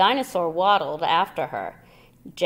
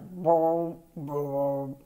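deep tuba bellow; 3 semitones lower than usual. A narrator's reading. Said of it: "deep bellowing sound like a tuba".

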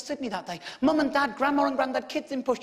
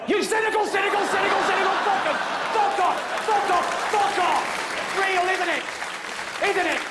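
loud, higher gloating yell; triumphant shout; 7 semitones higher, 4 dB louder than usual. Real, not acted. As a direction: crow over the doubters.

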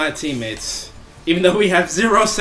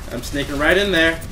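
ordinary talk

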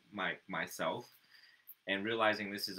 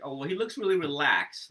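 mock senior teacher; 7 semitones higher, 10 dB louder than usual. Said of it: "be like".

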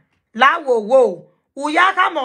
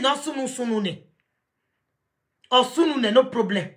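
ordinary delivery

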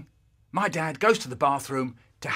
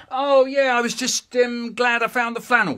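put-on loud, high, hasty boy excuse; boy's excuse; 10 semitones higher, 9 dB louder than usual. Boy calling down an excuse to mum, caught out. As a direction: cover up hurriedly, calling back. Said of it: "And I went".

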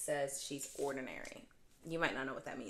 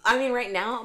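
loud outburst; 7 semitones higher, 14 dB louder than usual. Real, not acted. Emphatic outburst.

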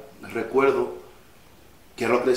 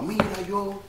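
reproachful mother; 8 semitones higher, 6 dB quieter than usual. Retold or imitated. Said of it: "me lo dijo como en son de reproche".

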